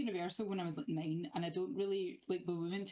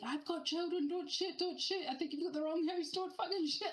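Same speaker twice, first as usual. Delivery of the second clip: higher, pleading don't shoot, imitating her mum; a retold frantic plea, lightly acted; panicked mother begging armed police, mock; 9 semitones higher than usual. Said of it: "And my mum was like".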